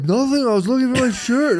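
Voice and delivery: high, defensive mock excuse; caught excuse. Husband caught on his phone, high and defensive. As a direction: squeak out a lame excuse. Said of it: "I'm like".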